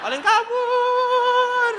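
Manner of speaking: long drawn-out high cry; seruan kabur. Retold or imitated. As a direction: call it out high and stretch the last syllable way out. Retelling a demon fleeing, drawn-out cry. (seruan melengking panjang)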